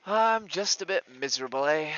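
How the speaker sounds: loud, high, sardonic outburst; loud sarcasm. Real, not acted. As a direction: throw it out bitterly and loud.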